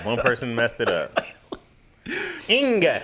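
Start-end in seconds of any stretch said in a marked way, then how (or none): 2.5-3.0 "Inga" loud and high, hard final G; exaggerated ending. Shown as a demonstration. Host mocking the misheard name ending, "Inga" with hard G. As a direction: punch out the G in "Inga".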